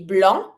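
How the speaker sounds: blanc ending on a nasal vowel; French "blanc" as blahn; silent final C. Shown as a demonstration. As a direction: leave the C off "blanc". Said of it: "finishing with the C".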